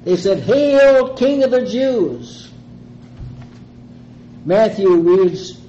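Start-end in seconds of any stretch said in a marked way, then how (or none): 0.4-2.5 high, loud, drawn-out mock hail; mocking salute. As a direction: hail him loudly in feigned honor. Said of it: "They mocked".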